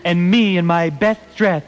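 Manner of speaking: measured comic verse with pauses; poem recitation. Acted. Host reads a bawdy Christmas parody, poem recitation. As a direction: recite it as mock Christmas poetry.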